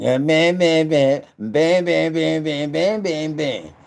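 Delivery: high, loud rapid-fire bam bam; mock prosecutor hammering. Mocking himself grilling his friend, high loud bams. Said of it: "like the prosecutor going".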